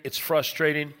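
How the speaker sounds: usual speaking voice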